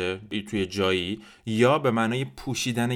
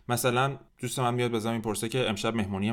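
ordinary talk, nothing marked

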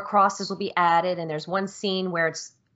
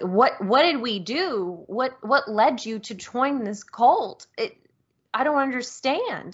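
distressed plea, slow and higher-pitched; pleading; 5 semitones higher, slower than usual. Retold or imitated. A dad to his daughter who joined a cult, pleading. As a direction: plead in drawn-out, swooping questions. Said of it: "he's pleading with her".

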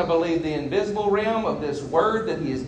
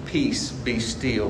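slow, softer, calm words to the storm; calm command; 6 semitones lower, 5 dB quieter, slower than usual. Acted. Jesus calming the storm, quiet and slow. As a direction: speak peace over the storm gently. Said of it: "release out of you what's already in you".

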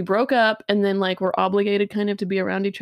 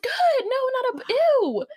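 high mock squeal of relief and disgust; squeaky disgust; 14 semitones higher, slower than usual.

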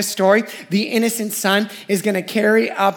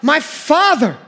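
Isaac calling out, pitched higher; a boy's cry to his father, higher; retold yell; 6 semitones higher, 3 dB louder, slower than usual. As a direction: call up the mountain to Dad. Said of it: "He yells out".

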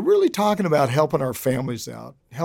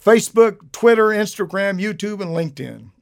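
upbeat announcer; 6 semitones higher, 4 dB louder than usual. Real, not acted. Host lists social media, announcer.

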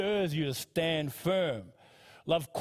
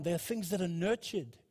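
normal talking voice

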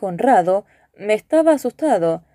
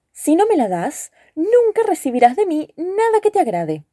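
mildly cross, high-pitched retort; lightly voiced huffy woman, pitched up; somewhat annoyed; 8 semitones higher than usual. A narrator's reading. Brunita threatens the cook who refuses her a leg, mildly annoyed. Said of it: "algo enfadada, repuso".

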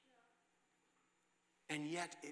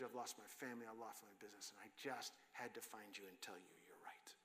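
softer and softer, ending in a whisper; fading to a whisper; 5 semitones lower, 10 dB quieter than usual. Retold or imitated. Friend admitting he lost everything, fading to a whisper. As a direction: let each loss drain the voice away.

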